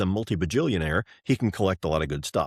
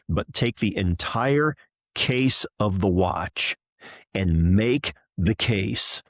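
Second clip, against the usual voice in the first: entire drawn out slow and leaned on hard; slow, each word weighted, entire hit hardest; stress on entire; 4 dB louder, slower than usual. Real, not acted. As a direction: hammer home "entire" without rushing. Insisting on the whole case.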